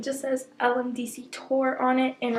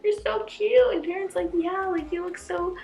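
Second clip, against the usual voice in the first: gushing compliment; 8 semitones higher than usual. Retold or imitated. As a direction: imitate stars cooing over a fan. Voices the stars complimenting her.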